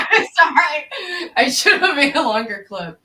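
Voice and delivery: apologizing through a laugh; giggling sorry. Real, not acted. Laughing apology after clip ends.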